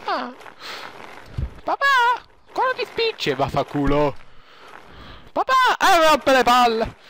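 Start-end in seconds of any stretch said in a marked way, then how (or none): 1.6-7.0 put-on very high kid voice; whiny child voice. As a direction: act a whining little kid. Child voice again.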